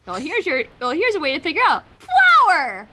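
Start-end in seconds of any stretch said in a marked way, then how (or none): 2.0-2.9 loud, high joke yell; mock shout. Friend joking about babysitting, loud mock yell. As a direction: bellow the word like a punchline.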